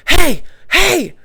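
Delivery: hollering across the kitchen for attention; yelled call. Retold or imitated. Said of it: "this guy yells from the kitchen".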